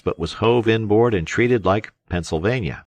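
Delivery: usual speaking voice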